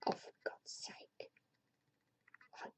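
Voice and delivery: whispered under the breath in despair; exasperated whisper. Real, not acted. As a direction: sigh it out as a whisper. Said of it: "exasperated whisper aside".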